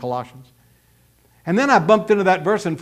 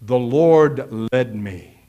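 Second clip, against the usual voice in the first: slow, low, drawn-out piety; pious mock testimony; 6 semitones lower, much slower than usual. Preacher mimics believers claiming divine leading, mock pious. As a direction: stretch each word like a solemn testimony. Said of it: "people talk like that".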